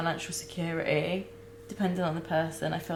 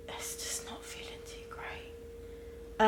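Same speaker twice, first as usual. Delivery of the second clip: slow, soft, voiceless whisper; real whisper; 9 dB quieter, slower than usual. Real, not acted. Mother confessing to her viewers, broken whisper. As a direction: barely breathe the words out, near tears.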